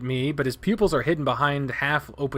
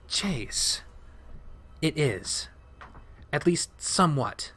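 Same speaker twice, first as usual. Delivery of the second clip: ordinary delivery, nothing marked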